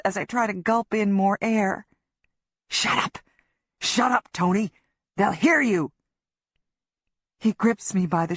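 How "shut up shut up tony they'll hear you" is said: tense panicked command; angry hushed shouting; hissed order. Acted. Griff hissing at her.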